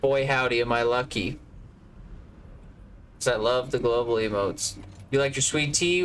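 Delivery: ordinary talk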